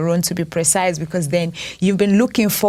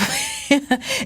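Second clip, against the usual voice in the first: breathy pained laugh; 8 semitones higher than usual. Real, not acted. Pained laugh mid-story.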